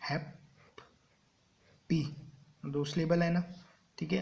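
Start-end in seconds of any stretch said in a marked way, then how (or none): none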